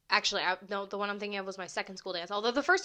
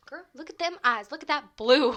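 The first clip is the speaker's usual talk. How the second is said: louder, higher admiring exclamation; sassy hype; 4 semitones higher, 9 dB louder than usual. Acted. Imagined onlookers admiring blue eyeliner, sassy hype. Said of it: "I wanna show up and be like".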